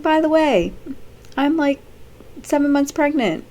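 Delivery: high, bright, casual drop of big news; offhand bombshell. Retold or imitated. Lisa casually tells Nate she is pregnant. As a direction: say it like it's nothing, pitched high. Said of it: "and she's like".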